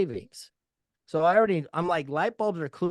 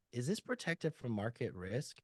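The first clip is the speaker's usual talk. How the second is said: much softer, casual, almost offhand; low-key and soft; 4 semitones lower, 11 dB quieter than usual. Shown as a demonstration. Agent easing the question to a client, soft and casual. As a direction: drop the volume and ask it gently by chance. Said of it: "did you notice how I'm talking kinda, like, upbeat".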